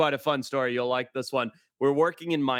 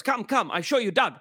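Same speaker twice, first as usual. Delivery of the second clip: higher mock fixer voice; coaxing broken-English invitation; imitating the fixer; 7 semitones higher than usual. Fixer beckons again.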